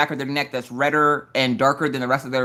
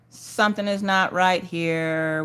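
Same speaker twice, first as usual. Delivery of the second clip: slow mock disbelief; 3 semitones higher, 4 dB quieter, slower than usual. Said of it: "where they're like".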